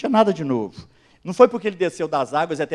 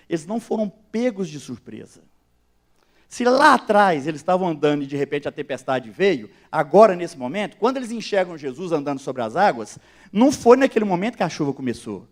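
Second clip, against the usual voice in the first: ordinary talk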